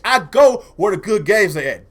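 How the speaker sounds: ordinary talk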